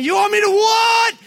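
very high, loud sudden shout; Hulk outburst. Retold or imitated. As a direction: explode at the request. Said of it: "Hulk outburst acted out".

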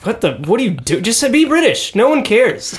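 exasperated. Retold or imitated. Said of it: "And you're like".

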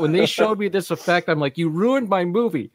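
Ivan Reitman. Retold or imitated. Director objecting to an effects shot, mildly hammed-up exasperation. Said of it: "Even Ivan Reitman said".

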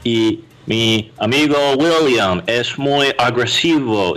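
slow, high, mock American accent in Spanish; mocking an American tourist's Spanish accent to friends; gringo Spanish accent. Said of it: "hablando ahí su español golpeado".

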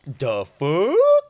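a short stunned question; baffled. Retold or imitated. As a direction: lizard man stunned, mock disbelief. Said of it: "And he's like".

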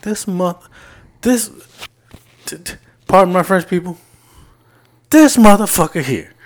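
high, loud exasperated outburst; exasperated rant. Retold or imitated. To the listener about the coworker who left him, an exasperated high outburst. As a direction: blow up in disbelief.

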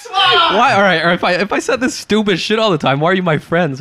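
indignant protest. Real, not acted. Teased friend challenging why they stayed friends, indignant.

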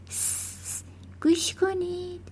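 long hissed shush, then high soft command; urgent hushing. A narrator's reading. Matilda silencing the family after switching off television, hushed urgency. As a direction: hush everyone so they can listen.

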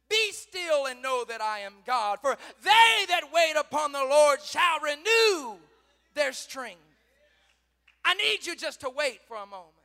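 loud proclamation, climbing high and drawn out; full-force preaching. Acted. Preacher giving God's answer to wait, full-force preaching. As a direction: proclaim the scripture at full volume.

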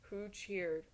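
usual speaking voice

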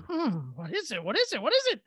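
acted worry, building with each repeat; mock rising panic. Acts out anxious guessing about fin.

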